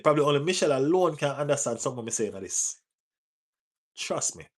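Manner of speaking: fast, thick Jamaican yardie patois. Shown as a demonstration. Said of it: "if me chat like a yardie".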